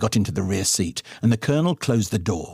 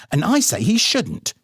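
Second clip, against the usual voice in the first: higher, louder arguing mother; mama; 8 semitones higher, 4 dB louder than usual. A narrator's reading. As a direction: mum snapping back at dad. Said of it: "came the voice of the ecologically sound mama".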